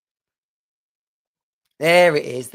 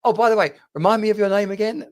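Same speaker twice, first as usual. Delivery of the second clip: higher, louder, rushed awkward question; higher, hurried, embarrassed tone; awkward late ask; 7 semitones higher, 5 dB louder, faster than usual. Shown as a demonstration. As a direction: blurt it out too late. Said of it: "you can't end the conversation with".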